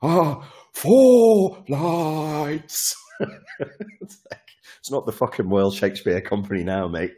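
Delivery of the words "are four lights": much higher, louder, very slow Shakespearean overacting; mock a grand stage actor stretching every word; hammed-up plummy actor. Mocking a Star Trek actor's overacting, hammed-up plummy delivery. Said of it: "Mister Plummy voice".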